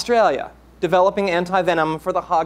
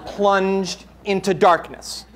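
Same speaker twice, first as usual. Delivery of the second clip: ordinary delivery, nothing marked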